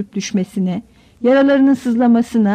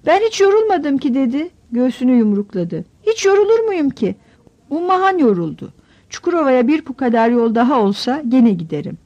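put-on a much higher child's voice, proud and bragging; boasting little boy; 4 semitones higher than usual. Young son boasting to his family after the long walk, proud child's voice. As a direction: puff up like a small boy who won't admit he's tired.

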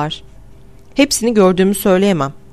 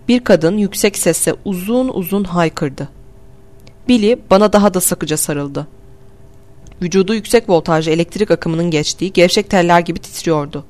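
usual speaking voice

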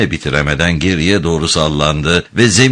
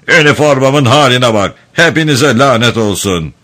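higher-pitched indignant outburst; angry roar; 6 semitones higher, 3 dB louder than usual. A narrator's reading. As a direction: curse them all in an angry character voice. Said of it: "diye kükredi Dodson". (öfkeyle kükreyerek)

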